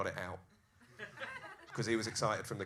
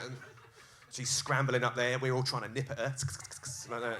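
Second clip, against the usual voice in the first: normal talking voice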